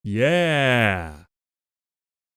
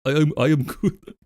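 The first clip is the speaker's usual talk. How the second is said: put-on Russian accent. Streamer voicing Comrade Goat in a light Russian accent. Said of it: "this accent. So let's do it in Russian".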